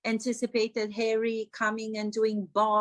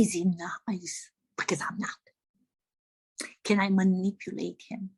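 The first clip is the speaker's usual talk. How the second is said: conspiratorial whisper; 4 semitones lower than usual. Acted. Actress plotting over a royal in a whisper. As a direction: hiss it as a wicked secret. Scheming aside about the royal.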